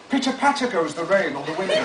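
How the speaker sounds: grandly narrated, savouring each phrase; dramatic book reading. Acted. Author reading his memoir to an audience, pompously. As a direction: read it like a pompous audiobook.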